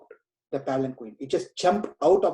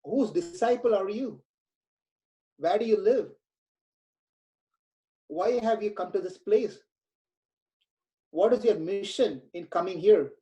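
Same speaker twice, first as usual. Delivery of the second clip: king's questions, higher pitched and eager; curious king; 3 semitones higher than usual. Acted. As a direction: keep questioning him as an awed king. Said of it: "He said".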